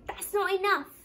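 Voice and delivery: loud, high wolf's wail. A narrator's reading. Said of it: "Wolfie wailed".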